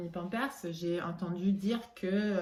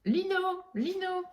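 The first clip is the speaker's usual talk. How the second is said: high ringing call of a name; 13 semitones higher, 7 dB louder than usual. Retold or imitated. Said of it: "en criant".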